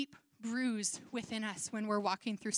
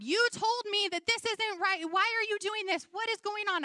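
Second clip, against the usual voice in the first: much higher, louder cry at God; anguished protest; 8 semitones higher, 4 dB louder than usual. Retold or imitated. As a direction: plead and rage upward like Job. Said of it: "He was saying to God".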